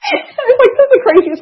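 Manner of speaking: high, laughing disbelief; giggling in real disbelief. Real, not acted. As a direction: laugh through the line at how absurd it was. Laughing retelling of a crazy clinic.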